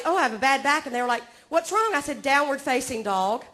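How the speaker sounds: ordinary talk, nothing marked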